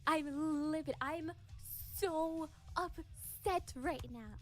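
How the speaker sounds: livid, very slow and deliberate. Real, not acted. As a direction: draw out each word, holding back the anger. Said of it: "I am livid".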